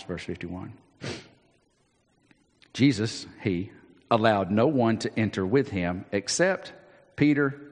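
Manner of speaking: normal talking voice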